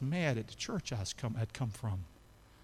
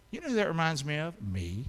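normal talking voice

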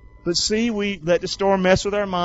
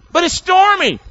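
worried protest; 12 semitones higher, 7 dB louder than usual. Pretend. Voicing the worried believer.

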